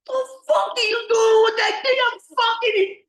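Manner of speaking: high, loud screaming fury; furious yell. Retold or imitated. Boyfriend screams over the other man's key, furious. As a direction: scream it in a jealous rage.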